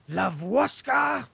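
loud, high, drawn-out angry call; angry shout. A narrator's reading. Denisov shouts angrily for his orderly on arriving home. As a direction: bellow the servant's name in a temper. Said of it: "he shouted loudly and angrily".

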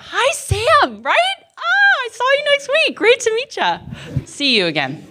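very high, squealed hello; gushing greeting. Real, not acted. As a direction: greet a new face with bubbly delight. Breaks off to greet someone excitedly.

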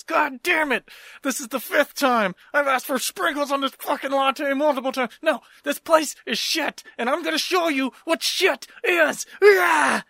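high, strained angry rant building to a yell; hammed-up furious customer. Customer snapping over missing latte sprinkles, furious rant. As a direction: blow up in mock fury, ending on a shouted cry.

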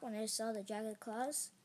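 plain reading voice

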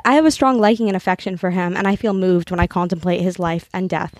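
earnest literary reading, lightly performed; reading a novel aloud. Acted. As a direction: read the passage like a heartfelt confession. Reads a novel passage aloud.

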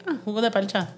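"palcha" with an L in place of the R, Puerto Rican style; Puerto Rican pronunciation. Shown as a demonstration. Explaining to viewers how Puerto Ricans say it, Puerto Rican pronunciation. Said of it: "But we say".

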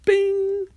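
high, loud "bing"; high ringing ding sound effect; bright-idea ding. Retold or imitated. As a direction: ring it out like a sudden chime. Imitates sudden realization chime.

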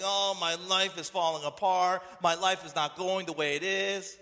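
hammed-up complainer's moan; whining and moaning. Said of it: "when you whine and moan".

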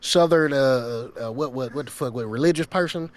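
put-on rambling Southern good-old-boy talk; Southern accent. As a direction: keep the Southern accent while telling a story. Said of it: "I did a, the Southern".

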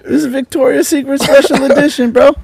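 high, laughing mock innocence; absurd denial. Mocking the angry boyfriend, high and hammed-up. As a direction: ham up the clueless act.

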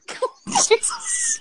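high snort of laughter. Real, not acted.

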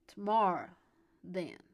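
put-on loud, drunken southern drawl; slurred southern drawl. A narrator's reading. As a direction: blurt it tipsily in a southern accent. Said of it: "the slurred southern drawl".

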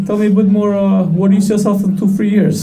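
mock job interviewer, high and laughing. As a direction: play the stiff interviewer with a giggle.

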